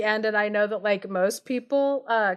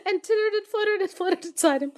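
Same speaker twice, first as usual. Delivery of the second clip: high, laughing through the words; can barely hold back laughter; giggling; 9 semitones higher, faster than usual. Real, not acted. Reader cracking up mid-sentence, giggling high.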